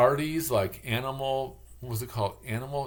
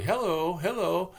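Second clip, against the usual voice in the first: higher, bright lilting Hello; lively English hello; 7 semitones higher than usual. Retold or imitated. Imitating lively English people, bright Hello. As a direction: greet like a lively English person. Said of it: "people are very lively".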